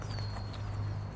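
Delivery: soft, breath-only sigh. Real, not acted. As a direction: let out a quiet breath. Pause in an emotional reading.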